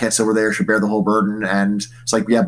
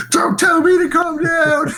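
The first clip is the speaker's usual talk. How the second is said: much higher and slow, hammed-up indignation; mock indignant retort; slower than usual. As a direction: snap back in mock offence. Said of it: "otherwise they may say".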